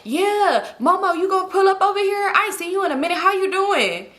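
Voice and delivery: high, friendly flirting voice, put on; mock sweet-talk. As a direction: imitate a man turning all sweet on the phone. Imitating him turning friendly to narrator.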